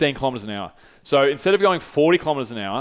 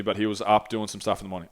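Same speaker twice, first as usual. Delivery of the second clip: ordinary delivery, nothing marked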